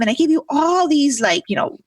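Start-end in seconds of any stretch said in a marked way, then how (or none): none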